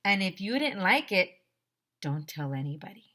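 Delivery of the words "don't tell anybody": plain reading voice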